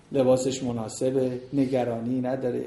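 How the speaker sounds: usual speaking voice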